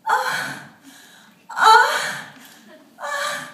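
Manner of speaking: high breathy sexual moans, rising; clit moan demo. Shown as a demonstration. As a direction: moan in short high gasps. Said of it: "There's the clit moan".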